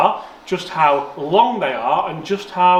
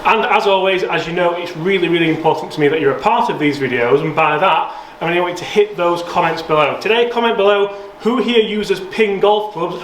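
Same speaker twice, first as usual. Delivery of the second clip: presenter voice; 3 semitones higher than usual. Acted. Presenter call to action.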